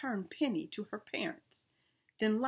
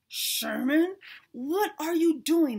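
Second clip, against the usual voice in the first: louder and higher, slow alarmed yell; 7 semitones higher, 9 dB louder, slower than usual. A narrator's reading. Peabody yelling up at Sherman flying past, loud and alarmed. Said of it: "Mister Peabody yelled".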